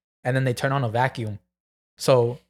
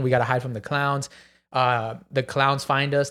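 ordinary delivery

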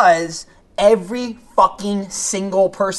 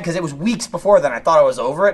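usual speaking voice

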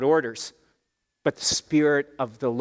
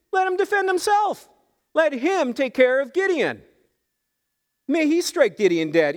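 defiant father; 10 semitones higher than usual. Retold or imitated. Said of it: "and he basically said".

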